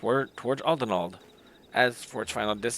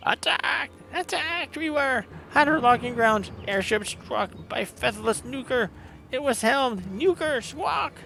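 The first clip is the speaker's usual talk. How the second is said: excited bird-man; 9 semitones higher than usual. Acted. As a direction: report the attack like a frantic bird warrior. Ixal messenger reports the raid.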